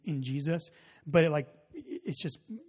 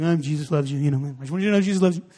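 almost sad; 2 semitones higher, 11 dB louder, faster than usual. Retold or imitated. Youth pastor awkwardly blurting to a stranger, mock pained. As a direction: imitate an awkward, pained blurt, building louder. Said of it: "almost, like, sad that he was saying it".

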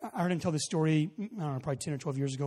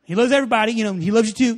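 almost sad; 2 semitones higher, 11 dB louder, faster than usual. Retold or imitated. Youth pastor awkwardly blurting to a stranger, mock pained. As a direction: imitate an awkward, pained blurt, building louder. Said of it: "almost, like, sad that he was saying it".

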